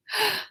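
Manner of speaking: sharp high gasp of amazement; surprised gasp. Acted. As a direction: gasp at the long list. List grows huge.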